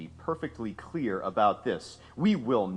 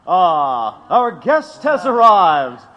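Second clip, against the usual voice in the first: sarcastic grand welcome; 6 semitones higher, 10 dB louder than usual. Acted. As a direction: roll out the welcome with heavy sarcasm. Mocking welcome to Parsons.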